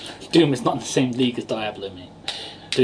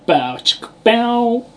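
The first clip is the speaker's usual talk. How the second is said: imitated catchphrase, rising high at the end; Tucker; 9 semitones higher, 4 dB louder, much slower than usual. Joking about what's inside the box, as an impression. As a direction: swing up loud and high on the last word. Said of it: "Inside is Tucker saying".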